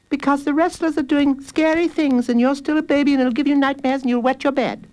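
put-on high, scolding girl's voice; high-pitched sisterly taunting; bossy sister. A narrator's reading. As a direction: lecture the baby brother in a high voice. Sister scolds little brother.

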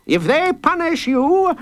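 high, slow courtroom call; judge calling out. A narrator's reading. Judge leading the court's big people, calling out. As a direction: proclaim it high for the crowd to finish. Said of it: "called out".

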